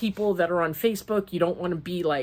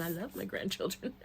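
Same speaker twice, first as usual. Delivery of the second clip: whispered again; 12 dB quieter than usual. Shown as a demonstration.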